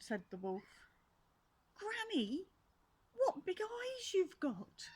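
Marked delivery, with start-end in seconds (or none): 1.7-4.9 put-on frightened girl.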